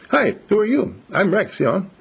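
high, eager puppy voice, quick at the end; happy young dog. Retold or imitated. Young dog Rex greets an older dog at the vet, high and eager. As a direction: bounce in as a wiggly friendly dog. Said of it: "wiggly, goggly, happy guy".

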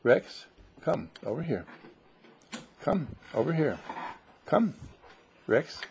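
ordinary delivery

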